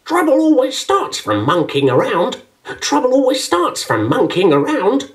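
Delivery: high, scratchy croaking bird; scratchy toucan voice. A narrator's reading. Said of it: "A scratchy voice suddenly croaked".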